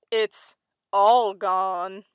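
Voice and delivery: very slow, drawn-out reveal; deliberate cat. A narrator's reading. Cat reveals the third name to mouse, slow deliberate voice. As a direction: stretch it out with a pause before the name.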